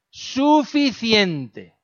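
the father warns a second time, firmer; slow rising stern repeat; sterner father. Retold or imitated. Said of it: "Hacía así".

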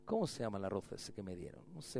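low quick aside to the side; muttered aside. Real, not acted. Aside asking name of rice.

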